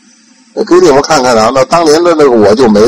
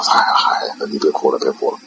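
put-on softer, coaxing the boy to stop crying; soft comforting; 8 semitones lower, 8 dB quieter than usual. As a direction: gently hush a crying friend. Ikkyu comforts crying senior monk. (轻声安慰)

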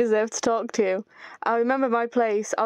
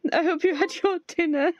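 mock crying; 6 semitones higher than usual. Reading Ray's line with fake tears.

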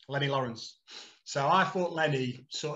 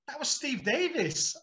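slow and much higher mock manager; deadpan correction; 10 semitones higher, slower than usual. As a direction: correct him slowly and flatly. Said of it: "And he went".

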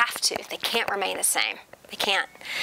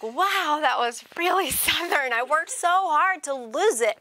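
put-on Southern accent; 6 semitones higher, slower than usual. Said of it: "I'm like".

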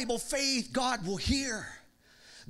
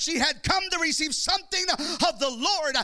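fiery preaching; 5 semitones higher than usual. Acted. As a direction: preach it out with rising fire. Preacher building to full force.

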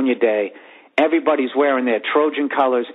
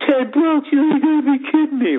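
high-pitched, indignant mock complaint; bitching customer; 13 semitones higher than usual. Customer griping to the program seller about the price, high mock indignation. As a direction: whine in outrage at the price, much higher. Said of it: "And the guy starts bitching".

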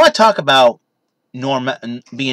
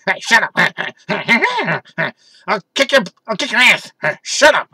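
mock cartoon teen; 8 semitones higher, 5 dB louder than usual. Beavis and Butthead bit continues.

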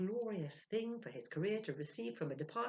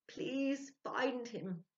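slow, high drawn-out plea; spirit's plea; 9 semitones higher, 3 dB louder, much slower than usual. Acted. Spirit Spinach pleading with Ludwig, high and very slow. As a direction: stretch out the plea, pitched up.

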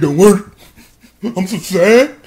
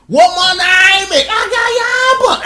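high, slow, drawn-out name announcement; villain girl introduces herself, high and slow; villain intro; 11 semitones higher, slower than usual. Retold or imitated. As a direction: strike a pose as you say it.

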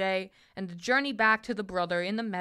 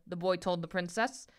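ordinary delivery

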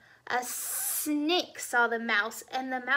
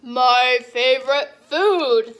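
hungry Gruffalo; 11 dB louder, slower than usual. Acted. Gruffalo meets mouse.

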